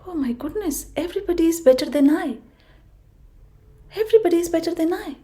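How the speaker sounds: high, fast, worried tortoise voice; despairing tortoise. Acted. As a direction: rush out the worry in a high voice. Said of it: "tortoise started thinking".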